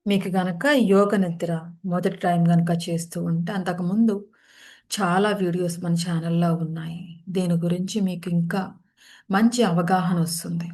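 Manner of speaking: unhurried phrases with long settling pauses; guided meditation. Real, not acted. Leads guided yoga nidra practice.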